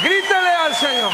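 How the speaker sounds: a loud, high command to shout; rally the whole church to shout; shouting to the Lord. Real, not acted. Preacher firing up the congregation, loud and high. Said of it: "Casi no los oigo".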